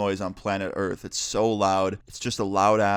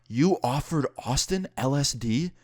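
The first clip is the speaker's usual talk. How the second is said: slow, higher-pitched imitated disbelief; retold husband, slowly demanding confirmation; incredulous husband; 4 semitones higher than usual. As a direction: draw the question out in disbelief. Husband confronts wife, retold.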